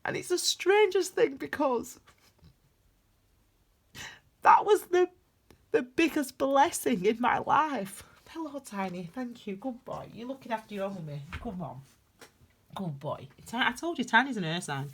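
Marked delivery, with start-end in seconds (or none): 0.0-8.0 about to cry. Real, not acted. Grieving granddaughter on camera, voice cracking near tears. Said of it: "I feel like I'm gonna start crying now". 8.3-13.3 quiet, soft praise to the dog. Real, not acted. Tearful owner thanking her dog, speaking softly.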